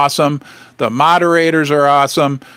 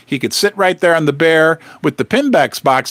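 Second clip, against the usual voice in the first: ordinary delivery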